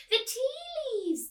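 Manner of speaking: put-on high, slow, loud old lady. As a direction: draw it out like a deaf old actress. Miss Spink mishears, slow and high.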